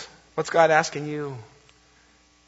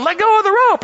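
much higher and louder shout; kid yelling; 8 dB louder than usual. Retold or imitated. Boy yelling at the girl to let go of the rope, loud shout. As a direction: bellow it up at her. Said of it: "the kid starts yelling".

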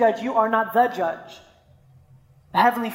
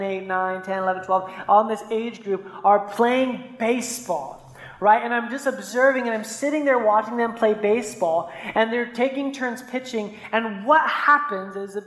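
ordinary talk, nothing marked

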